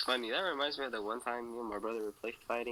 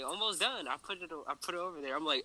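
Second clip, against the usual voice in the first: normal talking voice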